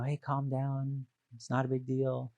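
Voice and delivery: calm reassurance. Retold or imitated. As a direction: settle yourself down gently. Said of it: "It may be going".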